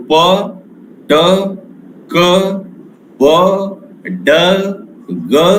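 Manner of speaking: each sound said alone with a pause; spelled out sounds. Shown as a demonstration. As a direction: sound out p, t, k, b, d, g one by one. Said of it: "For example".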